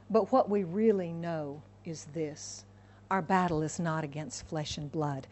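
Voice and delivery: ordinary talk, nothing marked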